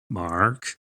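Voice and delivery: ordinary delivery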